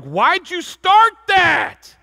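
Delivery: high, loud and slow, drawn-out disbelief; exasperated shout. Retold or imitated. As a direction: shake them with the question. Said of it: "I just wanna shake him and be like".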